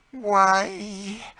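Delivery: high, loud, drawn-out exclamation; surprise and delight. A narrator's reading. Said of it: "with an accent of surprise and delight".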